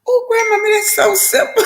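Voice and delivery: put-on high, light young woman's voice; granddaughter's voice. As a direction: pitch up as the relieved granddaughter.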